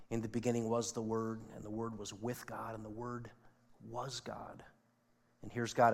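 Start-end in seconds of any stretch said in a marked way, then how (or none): 0.1-4.6 soft scripture reading, fading to a near hush. Acted. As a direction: read it softer and softer.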